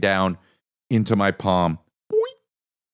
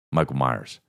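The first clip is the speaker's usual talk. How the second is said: normal talking voice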